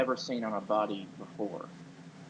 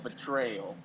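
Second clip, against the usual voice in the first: usual speaking voice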